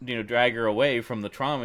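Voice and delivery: ordinary talk, nothing marked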